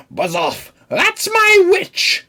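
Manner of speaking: very high, loud, slow screech; monstrous beast yell. A narrator's reading. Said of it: "a yowl and a growl".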